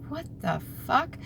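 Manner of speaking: hushed, high-pitched mock bewilderment; baffled reaction. Reacting to an unexpected inner voice.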